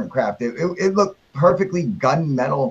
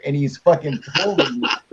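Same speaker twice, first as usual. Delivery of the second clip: ordinary talk, nothing marked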